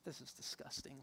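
very soft, voiceless grumble; hushed, fed-up remark; disgusted aside. Retold or imitated. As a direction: imitate a wife's disgust under the breath. Wife's disgusted aside, retold.

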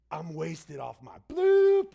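a slow, loud mock drunk boast; drunk slur. Drunk man boasting to stranger on cruise, slow loud slur. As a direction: slur it like a drunk guy bragging.

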